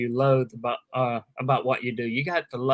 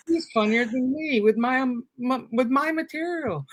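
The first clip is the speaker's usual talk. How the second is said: mock complaint in a much higher voice; high-pitched, half-joking protest at being outdone; retold dismay; 9 semitones higher than usual.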